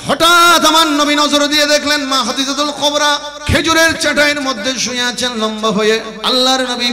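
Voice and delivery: high, loud intoned sermon narration; high melodic preacher's delivery; full-force preaching. Acted. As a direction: chant the story out, easing down at the end.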